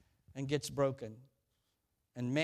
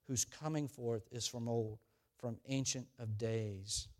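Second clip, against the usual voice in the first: scripture reading; 4 semitones lower than usual. Acted. Scripture read aloud.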